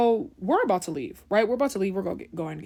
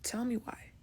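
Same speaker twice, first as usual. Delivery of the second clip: whispered aside; hushed disbelieving aside; 11 dB quieter than usual. Real, not acted. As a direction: drop to a conspiratorial whisper. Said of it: "whispered aside to audience".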